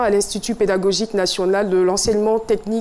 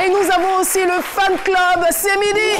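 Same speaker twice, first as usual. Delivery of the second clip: show host hype; 6 semitones higher than usual. Acted. TV host welcoming guests to the studio, hyped announcer voice. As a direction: greet the studio crowd like a game-show host.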